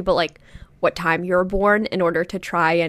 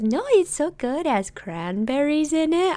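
high, slow singsong mom voice; imitated gushing mom; 7 semitones higher, 3 dB quieter, slower than usual. Mom sells the snack to daughter, high gushing imitation. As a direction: gush slowly as a doting mother.

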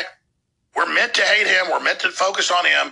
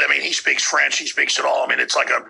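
usual speaking voice

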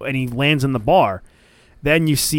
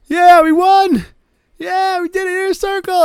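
high squeaky mock cheer; Sammy celebrating; 5 dB louder than usual. Sammy alone in the stadium, gloating: high mock cheer. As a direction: cheer high and loud like you just won. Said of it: "and he's like".

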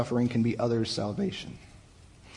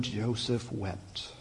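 hushed, slow sorrow; 3 semitones lower, 9 dB quieter, much slower than usual. A narrator's reading. Pastor reading how Joseph wept, slow and hushed. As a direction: drop to a slow, soft hush.

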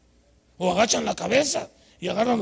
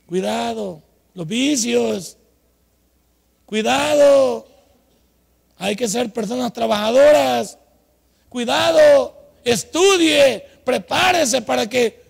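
parent's warning; 3 semitones higher, 8 dB louder, much slower than usual. Retold or imitated. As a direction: call out each word like a worried mother. Consejo de los padres voceado. (advertencia lenta y fuerte de madre)

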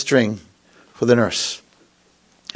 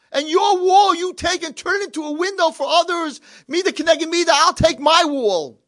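preaching at full force; 4 dB louder than usual. Real, not acted. Preaching climax, voicing God.

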